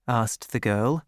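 ordinary delivery, nothing marked

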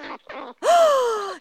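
high, loud gasp; big theatrical intake of breath; shocked gasp. Acted. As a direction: gasp in mock horror. Wonka sees squirrels' verdict.

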